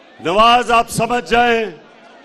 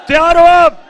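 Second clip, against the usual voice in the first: higher, louder call to the crowd; rousing the crowd; 4 semitones higher, 5 dB louder than usual. Real, not acted. Leader asks rally crowd if ready, loud rousing call. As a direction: whip the crowd up for an answer.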